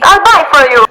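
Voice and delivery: loud, high, hammed-up Italian accent; bad Italian accent. Italian stranger trying to cheer up tourist, exuberant Italian accent. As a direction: cheer someone up in booming mock Italian. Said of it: "My Italian accent is so bad".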